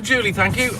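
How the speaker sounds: ordinary delivery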